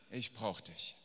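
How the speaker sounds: low, soft admission of need; quiet plea. Acted. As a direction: drop to a hushed, earnest appeal. Blind man's plea drops to confession. (leises, tiefes Flehen)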